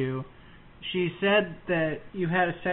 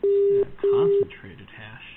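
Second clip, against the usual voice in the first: high, slow squeak; squeaky high voice; 4 dB louder, slower than usual. Pretend. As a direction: stretch it out in a squeak. Squeaky joke voice.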